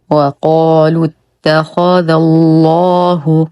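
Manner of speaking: very slow recitation, thick doubled ل in الله; heavy lam. Shown as a demonstration. Teacher reciting the verse, slow chant with heavy lam in الله. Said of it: "تشديد اللام المغلظة في اسم الجلالة".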